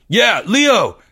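high, relieved shout; crying out in relief. A narrator's reading. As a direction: call out high to a rescuer. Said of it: "Greg cried in relief".

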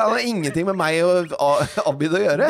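protesting to her co-host who keeps calling her Giæver, loud and indignant; heated protest. Real, not acted. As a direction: push back hard, half laughing. Heated protest, defending herself.

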